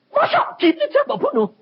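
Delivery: very high, loud mock wail; dismayed protest. Preacher protests God's threat to kill him, wailing in dismay. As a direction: squeal in hammed-up disbelief.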